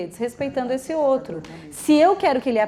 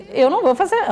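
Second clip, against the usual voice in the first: put-on defiant child; 7 semitones higher, 5 dB louder than usual. Child refusing teacher.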